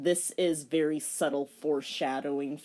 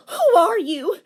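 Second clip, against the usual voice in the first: put-on angry high girl voice, much louder; more angry; 13 semitones higher, 11 dB louder than usual. Shown as a demonstration. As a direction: demand it, angry and loud. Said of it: "let me make it more angry".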